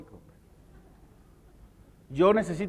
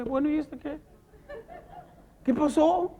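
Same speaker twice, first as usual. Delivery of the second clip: higher, offhand put-on voice of a young quitter; casual, higher mock voice of a quitter; mocking a careless young worker; 6 semitones higher, 3 dB quieter than usual. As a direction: shrug it off like a kid walking out. Young worker mocked, quitting job.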